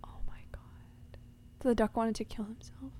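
stunned whisper; hushed shock. Real, not acted. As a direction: whisper it in mock-horrified realization. Hushed shock at dark discovery.

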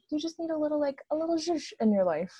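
Jonathan Van Ness. Retold or imitated. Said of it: "I'm quoting Jonathan Van Ness right now".

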